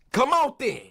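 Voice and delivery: ordinary talk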